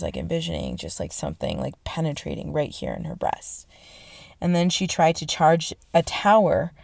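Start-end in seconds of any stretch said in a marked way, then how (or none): 0.0-3.7 much lower, soft foreboding tone; hushed ominous. Retold or imitated. Telling of Joan's vision, hushed foreboding. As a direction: drop the voice for the omen.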